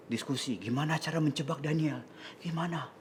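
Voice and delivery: hushed plotting among schemers; conspiratorial whisper. Acted. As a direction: whisper it softly, as if plotting in secret. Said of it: "Kenapa bisik-bisik?".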